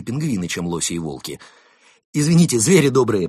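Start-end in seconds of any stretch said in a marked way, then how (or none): 2.1-3.3 loud, strained appeal; out of breath. A narrator's reading. Константин arriving from his run, out of breath. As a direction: squeeze it out while catching breath. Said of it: "пытаясь отдышаться, выдавил из себя".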